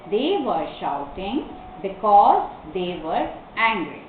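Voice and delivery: slow, measured read-through of examples; careful phrases with clear pauses; model reading. Shown as a demonstration. Teacher repeats all the sentences for the class, slow reading. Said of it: "I'll repeat the sentences again".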